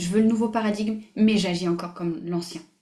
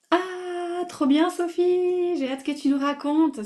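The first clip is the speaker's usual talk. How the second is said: high, drawn-out squeal of delight; 9 semitones higher, slower than usual. Real, not acted. Coach reacting to Sophie's message, delighted. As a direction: cheer her on, much higher, stretching the words.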